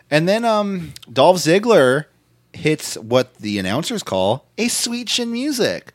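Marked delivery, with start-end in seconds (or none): none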